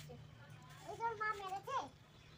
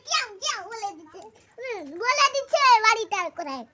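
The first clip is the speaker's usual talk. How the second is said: excited; 19 dB louder than usual. Real, not acted. Child excitedly reporting cat's meow.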